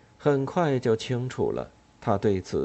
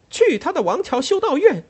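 high, loud ringing curse; furious shout; 8 dB louder than usual. Acted. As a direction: shout it to the rafters. Ellen on her feet, cursing.

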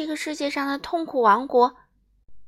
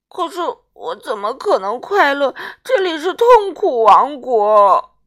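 a higher king's voice wailing through tears; crying king; 4 semitones higher, 10 dB louder than usual. A narrator's reading. King wails to Miss Sunshine about his misery, crying. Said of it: "国王哭着说".

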